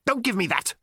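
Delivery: high, irritated alien snap; snapped. A narrator's reading. Alien dismissing Arthur's protest, snapping. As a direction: cut him off impatiently. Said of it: "snapped the alien".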